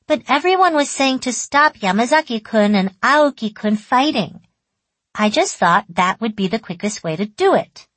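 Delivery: put-on high, loud childlike insistence; child patiently explaining. A narrator's reading. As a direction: explain like an earnest little girl. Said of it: "I explained patiently".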